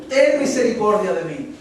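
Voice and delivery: crying out for mercy. Retold or imitated. Blind man begs Jesus for mercy, crying out. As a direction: plead out loud. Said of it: "clamaba más".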